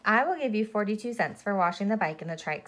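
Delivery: ordinary delivery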